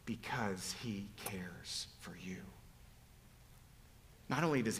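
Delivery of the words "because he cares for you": whispered, breathy and hushed. Real, not acted. Preacher whispers God's care.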